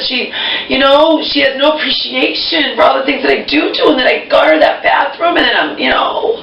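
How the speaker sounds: high, strained complaint; upset venting. Real, not acted. Venting about mom.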